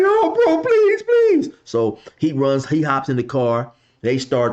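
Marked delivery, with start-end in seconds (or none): 0.0-1.5 very high, whimpering plea; mock begging dude. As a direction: squeal out the begging like a scared man. Driver begging after slap.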